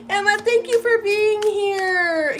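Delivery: high and loud, squealing her friend's name; overjoyed to see a friend show up in her live; thrilled surprise. Real, not acted.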